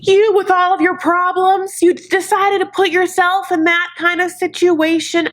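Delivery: high, strained scolding mother voice; horrified mom. Retold or imitated. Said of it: "The mom's like".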